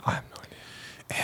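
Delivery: barely audible voiceless whisper; hushed whispered reply. Real, not acted. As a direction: whisper back, extremely soft. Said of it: "Why are we whispering".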